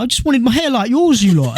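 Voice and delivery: high, hard-done-by uncle voice; imitating a disappointed uncle; pleading uncle. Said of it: "It was the way he said it".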